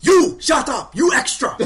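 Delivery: mock-outraged native yelling at an extra; angry shout. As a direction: bark it down at the lowly extra. GM voices angry native at player.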